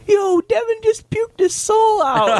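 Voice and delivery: put-on high, slow squeaky kid voice; excited kid voice. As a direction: mimic a little scout bursting in, high and drawn out. Kids run in with news.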